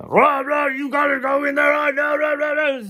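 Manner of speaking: mock the mad administrator as yapping noise; mock angry ranting. Mocking the angry jail administrator, high cartoon rant. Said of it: "starts getting real mad".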